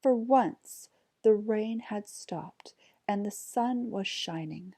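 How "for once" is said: usual speaking voice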